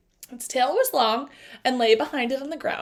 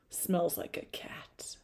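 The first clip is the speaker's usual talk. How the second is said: slow, breathy whisper of suspicion; wary whisper; 5 semitones lower, 11 dB quieter, slower than usual. Acted. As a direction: whisper it as if the beast might hear. Night Hand sniffs the air.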